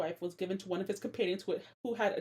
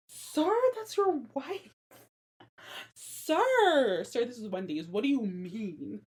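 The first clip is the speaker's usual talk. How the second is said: exasperated sir; 3 dB louder, slower than usual. Real, not acted. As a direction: talk to him like a rude customer. Scolding Samson, meme line.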